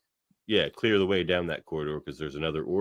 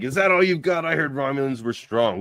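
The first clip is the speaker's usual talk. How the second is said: much higher cocky jeer; mock taunt; 7 semitones higher, 4 dB louder than usual. Shaxx fighting the Romulan, cocky taunt. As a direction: taunt him like a brawler.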